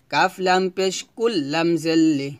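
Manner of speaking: usual speaking voice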